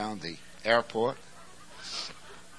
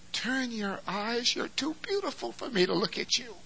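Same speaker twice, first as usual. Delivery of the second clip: bridegroom's tender words; 14 semitones higher than usual. Acted. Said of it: "This is the bridegroom saying".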